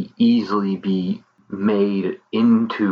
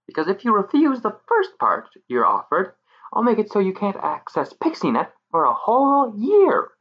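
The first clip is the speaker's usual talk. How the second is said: high menacing fairy voice; sweetly threatening pixie; 11 semitones higher, 3 dB louder than usual. Acted.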